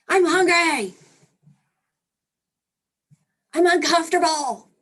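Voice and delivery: put-on a parent waking the grown kid, baby-style; baby's cries. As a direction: yell like a fussy baby waking you up. Parent imitating a crying baby.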